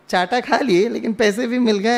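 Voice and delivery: usual speaking voice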